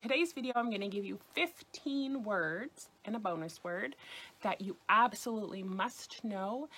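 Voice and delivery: usual speaking voice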